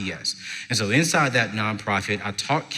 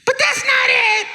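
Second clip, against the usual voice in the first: very high, loud mock wail; imitated bawling girl; tearful student; 5 dB louder than usual. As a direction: cry it out, hammed-up. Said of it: "and she's crying".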